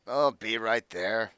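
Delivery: slow, groggy call; sleepy grumbling. Acted. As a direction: draw it out like someone just woken. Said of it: "you hear some grumbling from inside".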